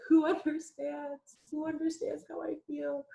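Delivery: put-on high, slow, whiny lament of a lonely teen; mock teenage angst. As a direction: wail it like a misunderstood kid pleading to the sky. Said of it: "I was like".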